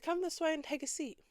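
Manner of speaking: high, sing-song customer-service voice; high mock-friendly store greeter; sugary staff voice. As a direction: mimic a chirpy associate ushering her. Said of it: "So they're like".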